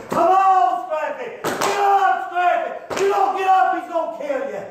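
high, strained calling to a downed fighter; re-enacted ringside urging; retold urgent urging. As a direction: plead with him to get up, reenacted at high pitch. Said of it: "I'm looking at him and I'm telling him".

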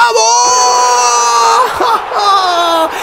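high shouting with laughter; goal shout. Real, not acted. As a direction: scream the goal in delight. Fourth goal celebration.

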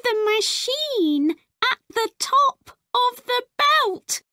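a frightened little robot; scared robot voice. Acted. Robot fears machine.

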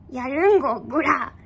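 put-on half-hearted tough-girl threat; mock yankee taunt. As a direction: bluff at the viewers like a pretend delinquent. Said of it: "ネットヤンキーになります".

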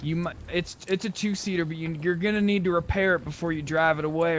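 ordinary delivery

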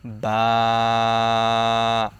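বা said from the moist part of both lips. Shown as a demonstration. Teacher demonstrating the letter, lip sound. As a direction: press the lips and say বা. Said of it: "দুই ঠোঁটের ভিজা অংশ হইতে".

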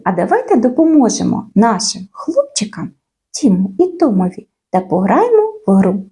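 ordinary delivery

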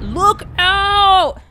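loud, high warning call; elephant shout. Acted. The elephant warns the moose, shouting. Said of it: "says the elephant".

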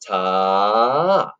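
TA held long over two beats; stretched half note. Shown as a demonstration. Teacher showing a half note, TA stretched long. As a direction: stretch TA out in one long sound. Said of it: "they stretch over two beats".

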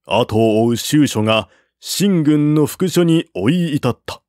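ordinary delivery

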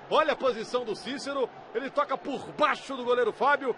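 ordinary talk

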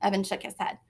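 plain reading voice

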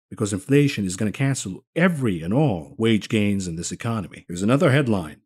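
ordinary delivery

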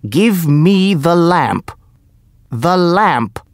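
high, loud furious order; snatch at it with the voice; a magician's angry shout; angry demand. A narrator's reading.

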